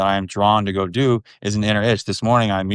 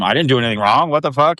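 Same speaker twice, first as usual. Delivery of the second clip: indignant outburst; 5 semitones higher, 3 dB louder than usual. Retold or imitated. Mimicking indignant parents.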